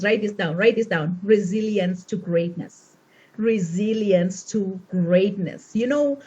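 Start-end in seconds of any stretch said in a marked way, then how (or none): 3.5-5.7 slow, measured dictation; slow emphatic repeat. Real, not acted. Speaker asking the audience to write this down, slow emphatic repeat. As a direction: spell it out slowly for note-takers. Repeats the title for note-takers.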